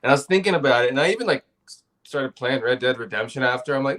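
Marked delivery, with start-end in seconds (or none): none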